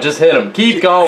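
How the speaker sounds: high-pitched joking urging; mock urgent order. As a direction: egg the driver on in mock alarm. Listener voices himself urging the driver.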